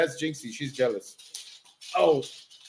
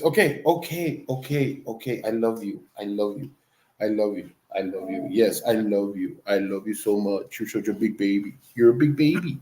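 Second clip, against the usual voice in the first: lower, softer, tender cooing to the dog; 4 semitones lower, 5 dB quieter than usual. Real, not acted. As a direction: soothe the pup with loving words.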